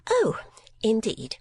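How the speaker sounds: high, airy mincing voice; hiding disappointment. A narrator's reading. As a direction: cover disappointment with a lilt. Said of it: "to conceal her disappointment".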